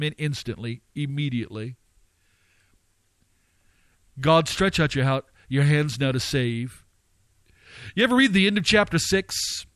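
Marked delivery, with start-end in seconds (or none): none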